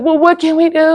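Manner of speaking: high, put-on helpless voice; mocking whine. Mimicking teammates on Zoom in a high helpless voice. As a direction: mimic them asking what to do.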